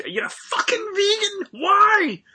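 high, loud, hammed-up outraged shout; mock fit. Yelling at his friend for going vegan, hammed-up outraged shout. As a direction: throw a mock tantrum at the word vegan. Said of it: "So I had a bit of a, a fit".

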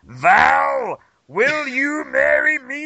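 high, slow, gravelly-free Macho Man plea; Macho Man impression. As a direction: ham up Randy Savage on one knee. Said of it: "down on one knee like".

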